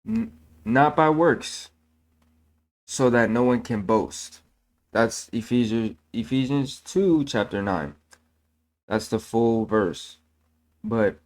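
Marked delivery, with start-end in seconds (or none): none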